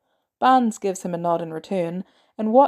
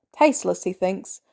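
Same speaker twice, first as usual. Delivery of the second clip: ordinary delivery, nothing marked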